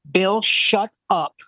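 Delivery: very slow, spaced-out command; scolding wife. Retold or imitated. Wife to talkative husband at show, stern scolding. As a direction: imitate a wife laying down the law.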